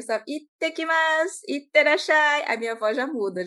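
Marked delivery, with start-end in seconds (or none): 0.3-1.4 "Ittekimasu" much higher, slow, rising; higher Japanese voice. Shown as a demonstration. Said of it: "já fica com uma entonação um pouco mais aguda". 1.5-2.4 "itterashai" much higher and louder; higher Japanese voice. Shown as a demonstration. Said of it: "já fica com uma entonação um pouco mais aguda".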